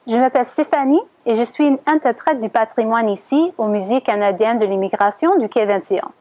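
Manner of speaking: usual speaking voice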